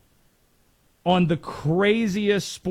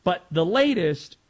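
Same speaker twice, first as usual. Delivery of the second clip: ordinary talk, nothing marked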